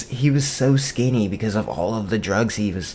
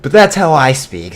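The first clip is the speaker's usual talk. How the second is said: louder, higher, laughing aside; 4 semitones higher, 9 dB louder than usual. Real, not acted. Teller admits that's how he speaks, laughing. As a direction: laugh through the admission.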